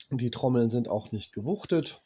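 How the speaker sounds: usual speaking voice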